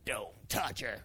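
low, quiet, firm command; acted stern wife, low and soft; stern low warning. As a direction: warn them off under your voice. Repeating wife's stern warning.

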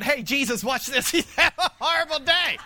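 laughing through a teasing mock quote; giggling mock divine aside; mock God voice. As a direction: tease it like a prankster, cracking up. Imagined God mocking, laughing.

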